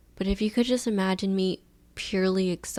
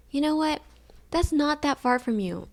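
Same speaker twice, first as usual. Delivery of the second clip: high friendly voice; 7 semitones higher than usual. Retold or imitated. Voicing the tow guy.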